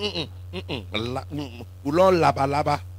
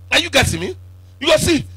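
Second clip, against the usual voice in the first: normal talking voice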